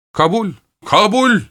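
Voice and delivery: very high, heated cry of acceptance; exclaimed shout. A narrator's reading. Danny giving in to Rivera's terms, high exclaimed shout. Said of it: "diye haykırdı Danny".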